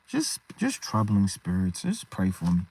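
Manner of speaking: downcast, troubled. Acted. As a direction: sound worn down by your troubles. Acts out troubled believer asking prayer.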